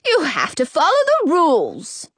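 high, scolding mirror voice; peeved rule-keeper; annoyed. A narrator's reading. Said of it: "The voice is definitely annoyed".

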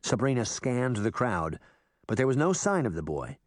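plain reading voice

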